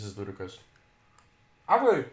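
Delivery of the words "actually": talking while eating; words round a bite of food; mouth full. Real, not acted. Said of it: "Sorry, I'm eating".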